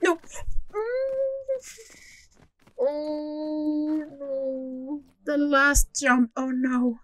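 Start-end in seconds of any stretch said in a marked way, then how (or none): 0.2-1.2 high frustrated whimper; a short pained whine. Real, not acted. Failed jump, whimpering sound.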